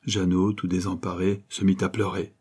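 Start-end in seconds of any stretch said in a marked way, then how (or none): none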